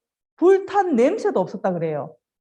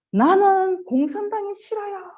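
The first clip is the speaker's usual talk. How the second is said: put-on high, slow child's voice; defiant little boy; 10 semitones higher than usual. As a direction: draw it out high like a brave child. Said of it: "그래도 그 소년은". (높고 느리게 외치는 용감한 소년 목소리)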